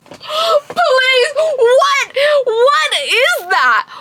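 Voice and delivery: shocked outburst. Real, not acted. Reviewer reacts to the hero's crude line, high shocked cry. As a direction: shriek your disbelief at the page.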